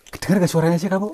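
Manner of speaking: fast, loud, high eager question; an excited rushed question to a friend. Real, not acted. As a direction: blurt it out in a rush. Excited asking if voice heard.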